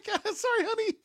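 high, squeaky mock apology; sheepish apology. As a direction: squeak out a guilty sorry. Acted sheepish apology to family.

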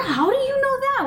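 high, stretched-out astonished question; mock amazement. Mother amazed her daughter knows the word, high surprise. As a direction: sound floored by the answer.

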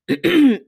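loud throat clear. Real, not acted. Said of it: "I don't know what's wrong with my throat".

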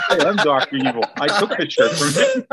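chuckling through the words; laughing. Real, not acted. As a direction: laugh while saying it. Joking he is Doctor Evil.

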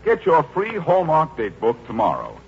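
ordinary talk

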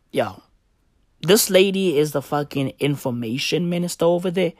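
ordinary talk

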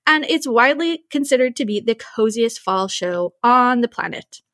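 normal talking voice

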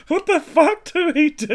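high, loud, laughing disbelief; cracking up. Retold or imitated. Retelling the eyebrow disaster before the meet and greet, cracking up. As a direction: shriek it while cracking up. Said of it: "I'm cracking up going".

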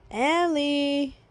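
long, stretched-out name; drawn-out call. Acted. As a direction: call out to wake a sleeper. Calling sleeping Ellie second time.

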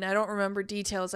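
ordinary delivery

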